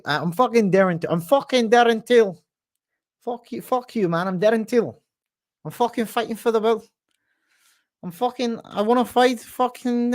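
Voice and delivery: high, badly faked Scouse accent; Darren Till. Fighter calling out his next opponent, high fake Scouse accent. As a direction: exaggerate a bad Scouse accent, pitched high. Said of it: "The worst Darren Till accent of all time".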